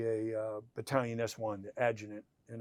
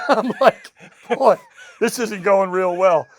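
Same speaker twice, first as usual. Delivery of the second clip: laughing through it; 9 semitones higher, 16 dB louder, slower than usual. Real, not acted. Laughing while retelling a bad start.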